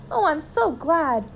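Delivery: high, drawn-out delight; overjoyed relief. A narrator's reading. Missus Gregg rejoices at being restored.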